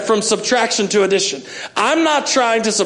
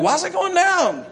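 high, slow mock whine; mock-distressed high whine over the bank account; whiny high complaint; 6 semitones higher than usual. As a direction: whine it up high like a worried child. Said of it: "I'm like".

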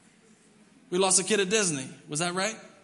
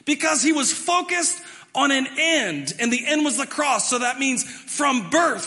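higher and louder, slow and driving; fervent preaching; 8 semitones higher, 6 dB louder than usual. Acted. Pastor preaching to the church, fervent and loud. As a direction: preach it full force to the congregation.

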